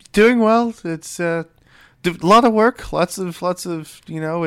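put-on high, boyish, hesitant chatter; young boy. Deckhand Enrique tells the captain how he is, high boy's voice. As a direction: stumble through it in a high boy's voice. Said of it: "a young boy about your age".

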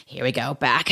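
ordinary talk

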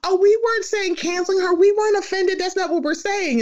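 high-pitched imitation of people backpedaling; mock backtracking.

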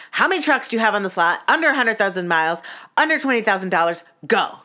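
fast, high, forceful questioning; retold angry impatience; mad, rapid-fire demand. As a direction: fire off the terms like orders. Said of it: "I was so mad".

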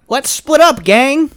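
high, loud heroic call; rally the gang like Fred; Fred catchphrase. Pretend. Winning the catchphrase argument, loud high Fred. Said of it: "Fred does have a fucking catchphrase".

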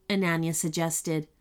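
plain reading voice

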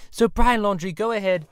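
ordinary delivery

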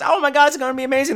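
high, fast, light gushing; giddy anticipation. Retold or imitated. Fan seeing the coming attractions, giddy excitement. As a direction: rush it out like a thrilled fan.

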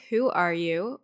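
normal talking voice

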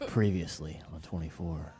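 low dramatic TV recap voice; ominous series-recap announcer; dramatic reality show hype. Retold or imitated. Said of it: "I think you sound like Kiefer Sutherland".

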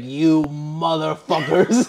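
hammed-up rage at the whole bathroom; mock angry man. An angry man in the urinal cursing everyone. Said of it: "he was so mad".

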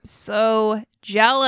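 slow, stretched-out words, fully voiced; long, emphatic, envious sigh of words; drawn-out envy. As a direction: drag out each word to show your envy. Repeats the envy out loud.

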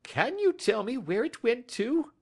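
very high, quivering-with-emotion little man; emotional cry. A narrator's reading. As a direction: cry out in high-pitched desperate hope. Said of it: "he cried".

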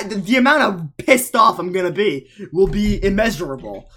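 usual speaking voice

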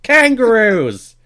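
loud, high hammed-up Australian accent; mock Australian accent. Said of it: "ran through all of my Australian stereotypes".

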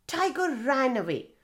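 plain reading voice

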